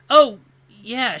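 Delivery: sudden realization. Acted. Suddenly remembers mom's trip.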